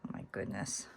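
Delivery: breathy whispered oh my goodness; whispered exasperation. Real, not acted. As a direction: whisper it in frustration. Struggling with tiny jump ring.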